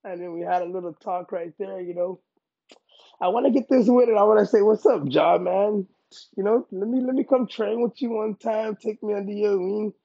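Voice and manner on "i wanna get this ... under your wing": loud, higher-pitched shout-out; eager call-out. Pretend. Fighter rehearsing his post-win mic plea, eager. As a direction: pitch it up and project to your hero.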